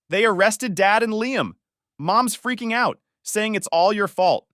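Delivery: distressed, high and fairly loud; tearful. A narrator's reading. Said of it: "Mia called me in tears".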